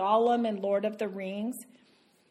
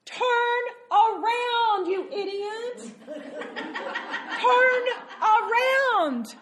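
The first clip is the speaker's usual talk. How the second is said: urgent mock scolding; 10 semitones higher, much slower than usual. Urging the lost driver.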